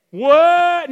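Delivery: high, loud astonished what; mock amazement. Preacher hearing Jesus was on the water, mock amazement. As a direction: burst out as if stunned.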